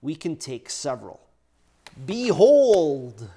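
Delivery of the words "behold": ordinary delivery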